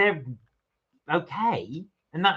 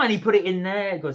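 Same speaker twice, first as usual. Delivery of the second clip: ordinary delivery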